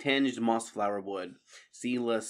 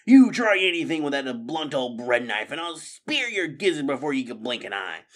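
louder angry rat; angry threat; 4 semitones higher, 7 dB louder than usual. Acted. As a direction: snap back a threat. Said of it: "He angrily jabbed".

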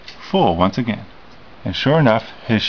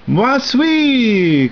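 loud, much higher French cry; excited cry; 13 semitones higher, 4 dB louder than usual. A narrator's reading. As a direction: alligator crying out as she gets up to dance. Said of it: "cried Alicia la alligator".

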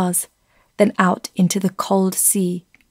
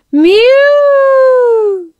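high, loud hooting whistle cry; ship whistle; 7 dB louder than usual. A narrator's reading. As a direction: blare it like a steamer whistle. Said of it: "The second whistle blared".

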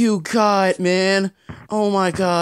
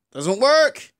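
exasperated repeat; 2 semitones higher than usual. Real, not acted. Exasperated repeat of same words.

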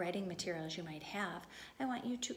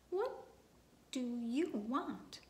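snapped; 3 semitones higher, much slower than usual. Acted. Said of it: "he snapped".

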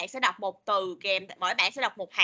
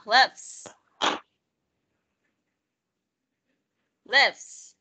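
"lives" louder, with the final s clearly sounded; said stronger; 3 dB louder than usual. Shown as a demonstration. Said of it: "mạnh lên". (đọc "lives" mạnh và rõ âm s)

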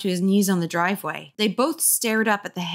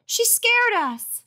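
put-on much higher tattling kid; high boy voice; slower than usual. A narrator's reading. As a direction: tell on her to mom. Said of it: "he told his mother".

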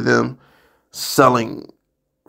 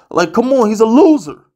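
higher and louder, fed up; exasperated outburst; 8 semitones higher, 5 dB louder than usual. Real, not acted. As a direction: blurt it out in disgust. Ranting about the quarterback.

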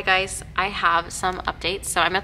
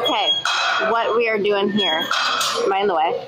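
raised voice over gym noise; loud over noise; 2 semitones higher, 5 dB louder than usual. Real, not acted. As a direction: project over the music. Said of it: "so much noise right now".

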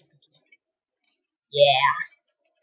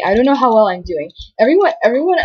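normal talking voice

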